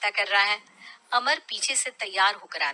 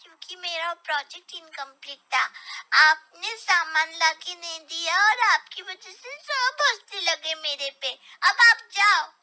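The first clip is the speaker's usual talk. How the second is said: put-on a hurt boy blaming his dad; upset child; 8 semitones higher, 5 dB louder than usual. Son blaming father for the scolding, upset child.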